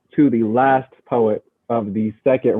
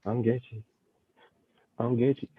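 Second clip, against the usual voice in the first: quiet aside; 11 dB quieter than usual. Real, not acted. Host mutters aside to crowd.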